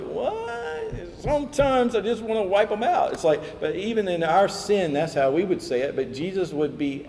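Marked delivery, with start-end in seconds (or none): 0.0-3.1 comic, strained exasperation; incredulous protest. Acted. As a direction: squeal it in mock disbelief, then complain. Mock protest at passive obedience.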